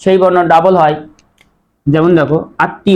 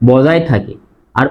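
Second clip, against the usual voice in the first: ordinary talk, nothing marked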